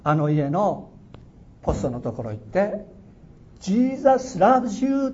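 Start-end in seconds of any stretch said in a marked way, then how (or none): 3.6-5.1 high, drawn-out shout of Jesus loves you; loud call. Retold or imitated. The Spirit telling him to shout it, a long loud call. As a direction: call it out slowly and loudly, like a voice from heaven. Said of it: "と大きな声で言いなさい".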